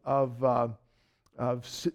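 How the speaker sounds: ordinary talk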